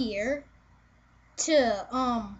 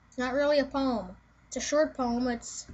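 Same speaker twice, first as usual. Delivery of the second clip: usual speaking voice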